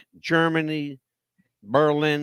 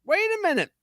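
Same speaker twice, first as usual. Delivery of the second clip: excited; 14 semitones higher than usual. Acted. Building up the eerie reveal.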